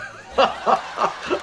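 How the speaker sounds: breathy scheming chuckle; a little villain's laugh before the threat; mock sinister chuckle. Fred plotting revenge on Lamont.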